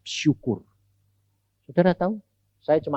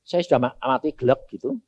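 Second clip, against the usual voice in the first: ordinary delivery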